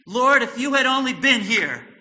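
loud, high pleading cry to the Lord; anguished cry. Retold or imitated. Martha crying out to Jesus, loud anguished plea. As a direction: cry out as if grief-stricken.

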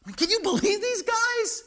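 high-pitched disbelief at their nerve; high, exasperated can-you-believe-it; incredulous. Real, not acted. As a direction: exclaim it in astonishment, pitch way up. Preacher incredulous at the brothers.

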